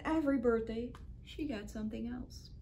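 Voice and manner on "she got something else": usual speaking voice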